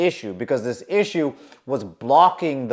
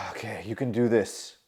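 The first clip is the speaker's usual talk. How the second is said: quiet pep talk; 6 semitones lower, 7 dB quieter than usual. Retold or imitated. Self pep talk after sigh.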